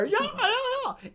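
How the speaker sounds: very high, excited yeah yeah yeah; imagined joyful cheer. Pretend. Groom imagines the engagement cheer, very high and excited. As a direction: squeal with pretend delight.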